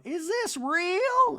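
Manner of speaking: sky-high slow incredulous question. Retold or imitated. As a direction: draw it out like a grand dame. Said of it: "[name] touching the clothing going".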